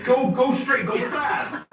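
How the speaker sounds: high, eager urging. Shown as a demonstration. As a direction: push the driver on with excitement. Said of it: "bantering along".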